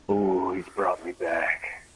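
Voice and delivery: lightly voiced eerie possessed persona; sinister other self. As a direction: savour each word like a dark persona taking over. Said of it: "transform my character".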